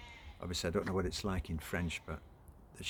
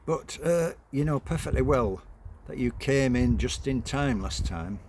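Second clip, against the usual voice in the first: raised mother's voice; 6 semitones higher, 7 dB louder than usual. Acted.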